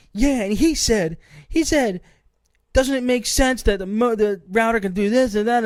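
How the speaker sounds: retelling how the agent mocked him to a coworker; mocking agent. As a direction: ridicule the customer's words in a high voice. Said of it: "mocking what I was saying to her".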